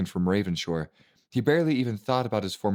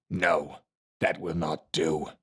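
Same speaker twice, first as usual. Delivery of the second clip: gravelly master voice; 3 semitones lower, slower than usual. A narrator's reading. Silent Master refuses.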